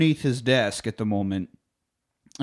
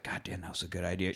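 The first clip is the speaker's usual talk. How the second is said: muttered to himself; 7 semitones lower, 14 dB quieter, faster than usual. Retold or imitated. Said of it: "J P's like".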